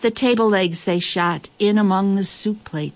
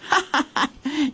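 short, higher-pitched scornful chuckle; mocking laugh; 10 semitones higher than usual. A narrator's reading. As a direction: laugh at him as a rival trader would. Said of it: "laughed at Captain Cat".

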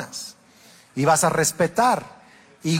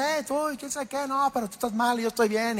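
much higher, quick mock bickering; family table talk; 7 semitones higher, 6 dB quieter, faster than usual. Family at the dinner table in a higher mock squabble. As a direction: imitate a noisy family arguing for fun. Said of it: "nos hablamos, pues, fuerte".

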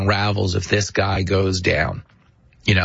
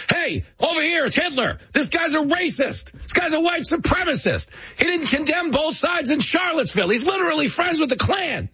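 much higher hammed-up hustler yell; mock Democrat heckler. Democrats heckle a crowd about Trump like a street hustler, much higher mock yell. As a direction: call out like the glasses con man. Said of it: "started walking down the street going".

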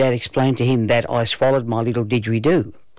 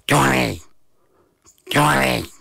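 gruff mystery voice calling; slower than usual. A narrator's reading. Hidden didgeridoo calling the boy by name, gruff. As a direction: call the name in a gruff creature voice. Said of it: "this gruff voice call my name".

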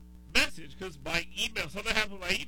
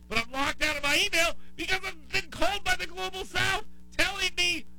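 exasperated rant; 11 semitones higher, 3 dB louder than usual. Real, not acted. Mock exasperated rant about the scam.

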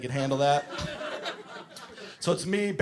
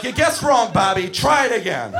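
exasperated; 4 semitones higher, 6 dB louder than usual. Retold or imitated. Forcing skates on kids.

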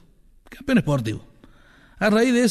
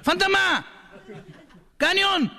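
very high yelled heckles; shouted cheers; 13 semitones higher, much slower than usual. Retold or imitated. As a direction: drunk fan yelling at singer. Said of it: "seguía gritando".

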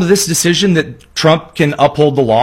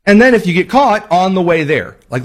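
ordinary talk, nothing marked

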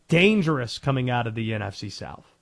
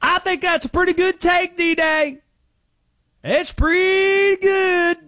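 high put-on voice; 6 dB louder, slower than usual. Mock voice for Conspiracy Josh.

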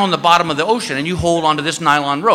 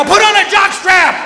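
much higher, loud angry yell; shouted retort; 14 semitones higher, 4 dB louder than usual. Retold or imitated. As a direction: bark it back at Paco. Yelling back at Paco.

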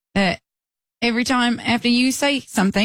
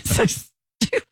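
breathless giggly whisper; 10 semitones higher, much slower than usual. Real, not acted. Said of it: "laughing at the game".

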